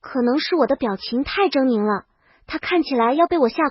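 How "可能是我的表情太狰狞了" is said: ordinary delivery, nothing marked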